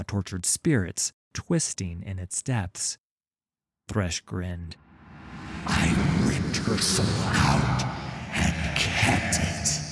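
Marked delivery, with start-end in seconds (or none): 5.7-9.8 slow rasping ghostly whisper; a sinister wraith savouring torment; cold hissing layered whisper. Acted. Thresh's voice.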